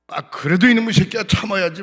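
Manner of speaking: father rebuking his son after the revival meeting, mock loud scold; father's scolding. Father scolding in retold story.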